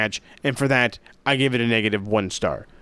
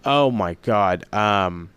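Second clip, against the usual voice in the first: ordinary delivery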